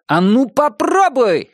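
high, slow mock-heroic cry; brave shout. A narrator's reading. Narrator parodying Tom Swift's dare, brave shout. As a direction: draw out a bold, high challenge. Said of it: "храбро выкрикнул Том".

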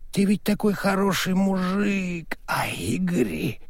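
put-on whispered drunken confidence.

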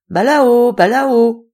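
appelle Balao deux fois, plus fort, d'une voix imitée; appel doux imité. Acted. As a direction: imite une femme qui appelle Balao à travers la forêt. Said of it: "en disant". (appel imité et un peu plus fort, sans crier)

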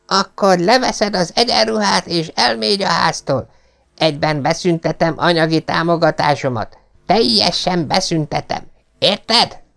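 pitched high, furious father; angry old lord. A narrator's reading. Lord Travers shouting at Pet to leave home. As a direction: throw him out of the house. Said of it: "kiáltotta".

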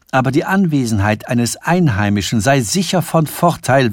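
ordinary delivery

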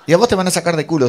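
fast, high, loud threat; mock threat. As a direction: heckle rudely from the crowd, hammed up. Otra voz amenaza en riña. (amenaza imitada, rápida y aguda)

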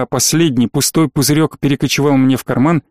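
ordinary delivery

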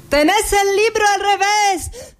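a high, teasing jeer from a passerby; mocking passerby. Passerby mocks child with book. (scherno di un passante che prende in giro la bambina, più acuto)

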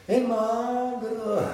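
a concerned woman drawing the words out; high, slow fussing tone to a pale thin man; woman's voice. Acted. Woman voiced noticing he looks dead.

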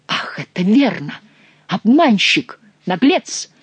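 loud, outraged insults. A narrator's reading. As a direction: spit the insults out in fury. Duke enraged at admission.

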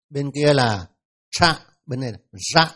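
ordinary delivery

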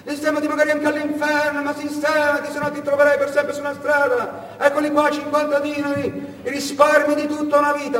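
the uncle handing over his savings, scolding; stern old uncle. Retold or imitated. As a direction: lecture the nephew from above. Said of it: "aveva detto".

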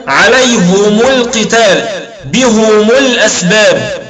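عليهم القتال and بهم الأسباب with both ha and mim given damma, slow; slow recitation, damma on the ha and the mim; Hamza and Kisai reading. Shown as a demonstration. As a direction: read عليهم as alayhumu and بهم as bihumu, slowly. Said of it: "فيقولون".

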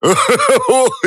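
высокий хохот до слёз; захохочи во весь голос; раскатистый хохот. Acted. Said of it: "задыхался Степан". (Степан хохочет до слёз)

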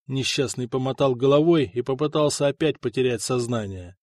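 normal talking voice

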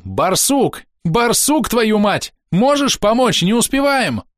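high-pitched frantic yelling; urgent shout. A narrator's reading. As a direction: shout for help with no time left. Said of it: "крикнул".

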